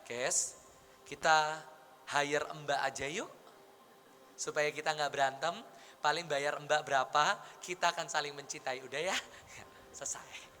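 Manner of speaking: low, soft peacemaking husband; unhurried, low-pitched conciliatory offer to his wife; calm reassurance. Retold or imitated. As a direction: coax gently, voice dropped low. Calm proposal to wife, against anger.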